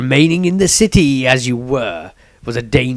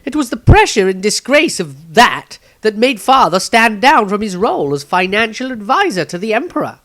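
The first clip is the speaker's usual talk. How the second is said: put-on higher, louder, hurried young man; young Maestro voice; 11 semitones higher, 6 dB louder than usual. A narrator's reading. Maestro quickly states, higher and louder. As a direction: blurt it out as the young apprentice. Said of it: "Maestro quickly stated".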